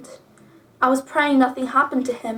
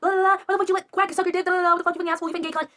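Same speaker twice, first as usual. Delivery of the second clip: fast, higher garbled rant without real volume; rapid-fire imitation of yelling; mock yelling; 6 semitones higher, 3 dB quieter, much faster than usual. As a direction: rattle off a mimicked screaming match. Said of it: "they're, like, yelling at".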